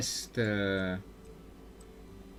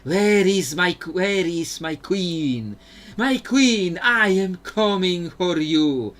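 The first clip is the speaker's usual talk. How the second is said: higher, louder, drawn-out spectral wail; haunting ghost king; 5 semitones higher, 7 dB louder, slower than usual. Retold or imitated. Viego lurking in the bay calling for his queen, haunting wail. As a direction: moan it like a ghost pining for his lost queen. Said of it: "just going".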